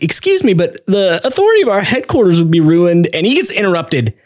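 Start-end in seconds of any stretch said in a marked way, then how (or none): 0.0-3.1 high, timid officer voice; mock officer. Underling protesting to his commander, high and meek. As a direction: squeak out a nervous objection. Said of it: "but he's like".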